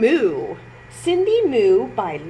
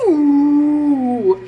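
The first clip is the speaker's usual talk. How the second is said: loud drawn-out cow moo; mighty moo; 4 semitones higher, 8 dB louder than usual. A narrator's reading. Narrator mooing as Cindy leaps. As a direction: bellow it like a cow. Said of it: "with a mighty".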